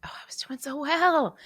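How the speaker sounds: whispered through real tears; tearful whisper. Real, not acted. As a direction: whisper it while starting to cry. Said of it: "on the interwebs crying".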